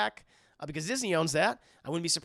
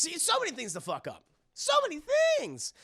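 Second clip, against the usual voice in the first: mock exasperation; 11 semitones higher than usual. Streamer listing things Avi could ruin, rising exasperation. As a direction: pitch climbing sharply to a high cry.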